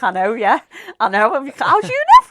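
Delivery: loud, high, incredulous retort; startled disbelief. Retold or imitated. Client to healer who sees her demon, loud and startled. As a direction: burst out in shocked disbelief.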